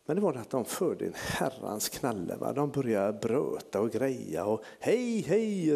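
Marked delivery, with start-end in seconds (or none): 4.8-5.8 high calling out; high, light call from the back. Retold or imitated. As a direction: call across the church to get attention. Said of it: "ropar hon med rollatorn".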